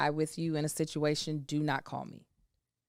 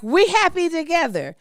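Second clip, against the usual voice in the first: higher and louder, sing-song gushing; mock empathetic people; 12 semitones higher, 7 dB louder than usual. Overly empathetic people mirroring your mood, gushing high voice. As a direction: overdo the cheerful agreement. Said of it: "They're like".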